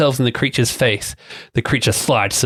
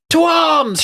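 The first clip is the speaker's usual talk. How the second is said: high, sharp rallying shout; shouted battle cry. A narrator's reading. Narrator voicing the hero's shout, high call to arms. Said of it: "shouts J R Lockwood".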